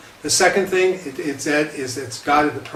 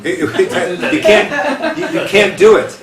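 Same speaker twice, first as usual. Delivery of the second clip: higher, louder, breaking into laughter; laughing attempt; 5 semitones higher, 5 dB louder, slower than usual. Shown as a demonstration. Rabbi attempts the unpronounceable name, laughing. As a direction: try the word and collapse laughing. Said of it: "it would sound a little bit like this".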